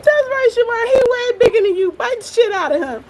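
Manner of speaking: ordinary delivery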